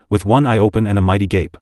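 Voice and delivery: ordinary delivery, nothing marked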